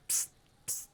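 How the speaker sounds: a quiet unvoiced psst to get attention; psst hiss. Player hisses at Kuno to pull him aside, hushed.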